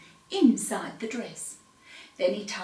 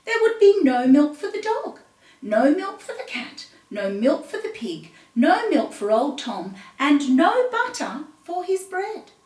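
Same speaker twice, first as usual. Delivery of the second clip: dramatic; 5 semitones higher, 5 dB louder than usual. A narrator's reading. Despairing list, no milk.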